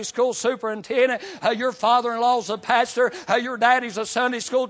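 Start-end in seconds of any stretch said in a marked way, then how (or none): none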